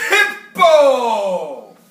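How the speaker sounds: bursts out loud on the name; the rhino finally shouts his own name. A narrator's reading. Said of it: "gasped the rhinoceros".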